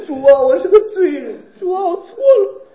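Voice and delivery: high, loud crying confession; mock wailing prayer. Imitating believers confessing sins on entering, in hammed-up weeping. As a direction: wail it out, pitch climbing into a sob. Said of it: "进屋就哭".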